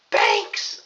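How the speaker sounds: high-pitched mocking screech; Alicia Fox. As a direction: shriek it loudly as a mocking impression. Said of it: "her voice, ah, like, I don't".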